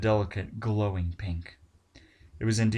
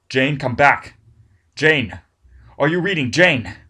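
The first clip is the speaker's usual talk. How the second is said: loud, urgent calling; radio operator calling a dying diver, desperate and loud; 6 semitones higher, 11 dB louder, slower than usual. Acted. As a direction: call her name into the radio, pleading. Tina calling over radio.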